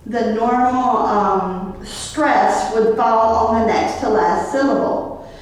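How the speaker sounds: ordinary talk, nothing marked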